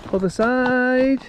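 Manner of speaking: slow, high drawn-out call; slow command. Real, not acted. Handler calling the pony round, slow and high. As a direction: stretch the command out slowly.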